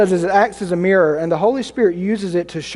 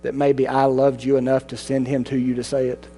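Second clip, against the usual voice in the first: a soft, lower divine reply; God's gentle answer; 5 semitones lower, 5 dB quieter than usual. Acted. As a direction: lower and softer voice of God. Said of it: "And God might respond".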